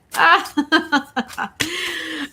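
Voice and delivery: long rippling giggle; amused laugh. Real, not acted. Host amused by own Southern accent, amused laugh. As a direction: burst of chuckles at herself.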